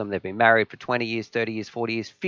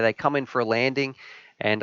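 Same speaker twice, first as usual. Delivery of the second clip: ordinary delivery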